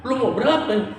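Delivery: hammed-up cranky market seller; mock rude trader. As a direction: play the grumpy seller brushing off a buyer.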